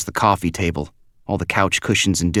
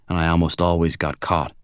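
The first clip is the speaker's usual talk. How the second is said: ordinary delivery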